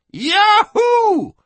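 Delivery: very high, loud cowboy yahoo; exultant whoop. Acted. Drunk freed from jail, exultant whoop. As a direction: whoop it out in wild joy. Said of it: "the whiskey soak exulted".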